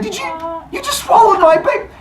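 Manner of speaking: put-on high shrieking outrage at the swallowed baby; shocked cry. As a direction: shriek it in disbelief. Rhea freaks out.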